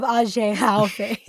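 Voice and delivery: drawn-out fake-French Ahegao, laughing; mock French. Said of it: "sexy, uh, Japanese French".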